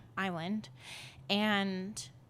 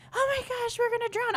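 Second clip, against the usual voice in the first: much higher mock panicked little-girl voice; panicked little sister; 3 dB louder than usual. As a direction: squeak it out like a scared four-year-old.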